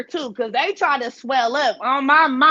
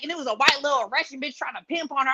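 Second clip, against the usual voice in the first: ordinary delivery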